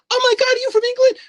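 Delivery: very high, fast, loud squeal; mock fangirl shriek; excited girls. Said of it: "And they'd be like".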